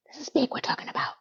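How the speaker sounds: fast knowing whisper; fast conspiratorial whisper. Real, not acted. Knowing aside about Big.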